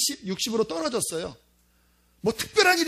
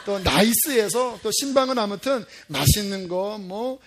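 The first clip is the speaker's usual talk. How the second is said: usual speaking voice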